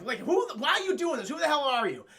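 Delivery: acted flustered indignation; indignant demand. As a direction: demand answers, exasperated. Acted princess confronts her captor.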